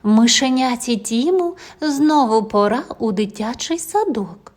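usual speaking voice

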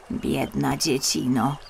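put-on old woman pitying the lost child. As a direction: speak with an old woman's pity. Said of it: "rzekła staruszka".

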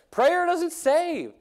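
high and very slow, each word hammered; emphatic preaching. Real, not acted. Preacher warning the congregation, slow and emphatic. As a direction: drive it home word by word.

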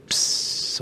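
soft unvoiced psst of a silent printer; printer hiss. Showing the accountants the silent thermal printer, a soft hiss. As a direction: breathe out a quiet hiss like the printer. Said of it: "the printer goes".

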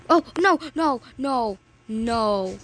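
alarmed. Real, not acted. Nearly falls.

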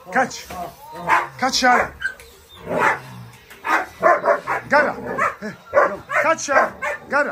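high, excited commands to the dogs; urging the dogs to catch. Real, not acted. Man urging his dogs to catch, high eager commands. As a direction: egg them on to catch it.

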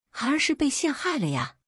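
high-pitched plea of innocence; pleading protest. A narrator's reading. Accused son pleads innocence to father.

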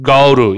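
"go to" run together as "go-ru"; fast linked pronunciation. Shown as a demonstration. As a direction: soften the t in "go to" to a tapped r. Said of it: "얘를 빨리 발음하면".

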